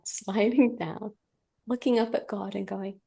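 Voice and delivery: normal talking voice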